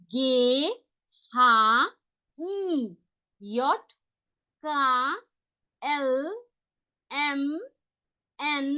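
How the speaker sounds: each German letter sounded out slowly with pauses; German alphabet read aloud. Shown as a demonstration. Teacher to online class, reciting the German alphabet slowly. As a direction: spell out the alphabet letter by letter, pausing between. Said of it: "শুনে আমি প্রত্যেককে একবার পড়তে বলবো".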